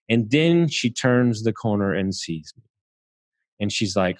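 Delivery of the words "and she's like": ordinary talk, nothing marked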